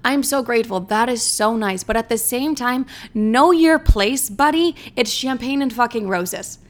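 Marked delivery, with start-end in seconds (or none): 3.1-4.8 slow, pointed put-down, louder and higher; sassy slow scold. Real, not acted. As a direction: drag out "know your place" like a warning. Scolding date's entitled attitude.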